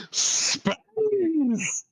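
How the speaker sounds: very high, breathy theatrical exclamation; Tim Curry impression. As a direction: gasp then cry out the word Space. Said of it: "No, he says it more like".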